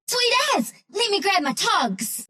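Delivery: high, excited whoop of words; delighted cheer. Acted. Game character thrilled at a swim, excited cheer. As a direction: cheer it, drawing out the end.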